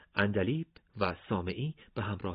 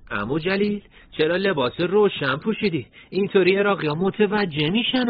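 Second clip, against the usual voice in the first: Morteza's Isfahani voice; 11 semitones higher, 8 dB louder than usual. Acted. Morteza scolds Jalil's light shirt.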